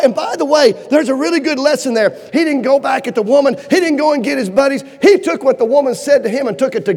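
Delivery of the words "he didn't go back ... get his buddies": ordinary delivery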